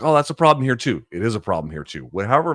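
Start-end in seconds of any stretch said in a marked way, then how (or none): 0.0-1.0 higher, louder mock protest; imagined objection. Voicing viewers who say it happens here, protesting. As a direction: pitch it up as someone else's objection. Voices a listener's likely objection.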